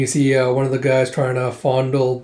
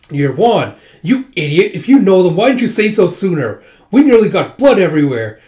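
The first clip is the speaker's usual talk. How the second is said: loud, raised acted tirade; angry scolding; 4 semitones higher, 7 dB louder than usual. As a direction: scold a friend for nearly causing bloodshed.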